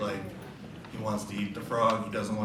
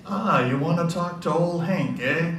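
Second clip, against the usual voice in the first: put-on gruff old goat-man in a rough Irish Boston accent, higher and louder; 7 semitones higher, 6 dB louder than usual. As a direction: play Hank with a loose Irish Boston lilt. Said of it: "yeah, Irish Boston accent".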